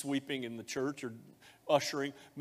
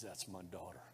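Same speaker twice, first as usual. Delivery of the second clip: soft, hushed fatherly voice; tender blessing; 6 semitones lower, 14 dB quieter than usual. Acted. Pastor speaking God's blessing over the baptism candidates, soft and tender. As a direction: murmur it like a loving father.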